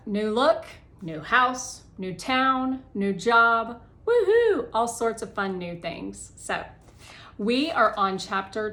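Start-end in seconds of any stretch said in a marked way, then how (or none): 4.1-4.7 loud, high cheer; excited whoop. Real, not acted. Cheering her own fresh start to listeners, excited whoop. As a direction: whoop it out with glee. Cheering about new things.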